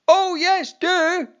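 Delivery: very high, loud gleeful chant; crowd shout. Acted. Audience answers the boy, a very high loud gleeful shout. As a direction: roar it back like a panto crowd. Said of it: "came the response of the audience".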